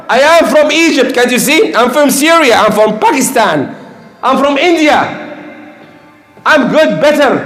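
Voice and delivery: high-pitched mock bragging; boastful national pride. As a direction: proclaim each claim like a puffed-up braggart. Acts out people boasting of nationality.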